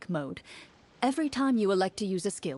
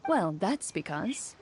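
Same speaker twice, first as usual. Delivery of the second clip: ordinary talk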